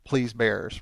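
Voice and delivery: ordinary talk, nothing marked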